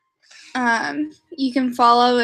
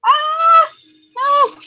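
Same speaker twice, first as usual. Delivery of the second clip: startled cry; 14 semitones higher, 5 dB louder than usual. Real, not acted. Sudden alarmed cry.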